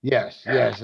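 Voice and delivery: usual speaking voice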